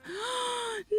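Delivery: sudden high gasp of alarm; sharp shocked gasp. Real, not acted. Streamer gasping at a bad move on stream. Shocked at her own gameplay.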